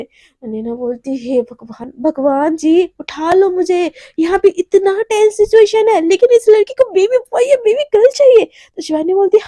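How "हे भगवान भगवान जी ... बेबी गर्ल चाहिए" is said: higher, louder wail to the heavens; exasperated plea. Acted. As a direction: plead with God in mounting exasperation. Exasperated plea to God in acted quarrel. (झुंझलाकर भगवान से ऊँची गुहार)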